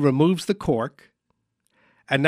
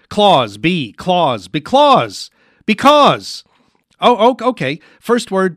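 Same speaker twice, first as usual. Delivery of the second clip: building to a loud, high eureka; excited guessing; 4 semitones higher, 7 dB louder, slower than usual. Pretend. Host cracking a mime's charade, mounting excitement. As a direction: piece it together louder and higher until it clicks. Solving charade, the word clicks.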